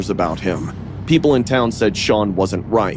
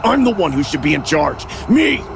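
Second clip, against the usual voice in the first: fast, raised rant bursting on the last word; angry growl; 6 semitones higher than usual. A narrator's reading. Brother claiming he should be in charge, angry growl. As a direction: rage faster, then jump high on ME. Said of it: "he growled".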